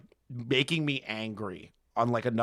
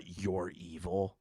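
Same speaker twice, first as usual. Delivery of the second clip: slow, soft and lower, a mild imitation of a chilling tone; mock cold menace; 7 semitones lower, 8 dB quieter, much slower than usual. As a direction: drag it out quietly like a mild threat. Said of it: "like, with that tone".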